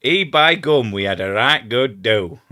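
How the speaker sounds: loud, hammed-up broad Yorkshire; bad Yorkshire accent. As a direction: play a jolly Yorkshire local, badly. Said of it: "So you've got Bad Yorkshire".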